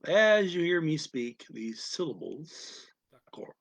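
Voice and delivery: ordinary delivery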